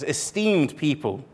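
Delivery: ordinary talk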